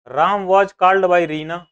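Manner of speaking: slow, deliberate dictation for students. Shown as a demonstration. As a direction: read it out slowly and firmly for the class to copy. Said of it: "जरा इस वाले sentence को देखो".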